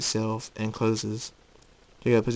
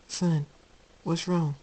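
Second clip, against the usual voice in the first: higher, gentle concern; worried mother; 7 semitones higher, slower than usual. Acted. Luna seeing her son grab his head, gently worried. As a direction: ask tenderly in a high motherly voice.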